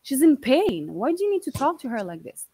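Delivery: defending the dying aunt against mom, heated; angry plea. Retold or imitated.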